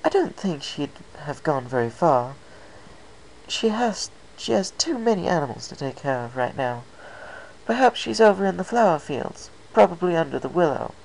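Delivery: high, refined pony lady, gently musing; light, elegant mare considering where her friend went; Rarity's voice. Acted.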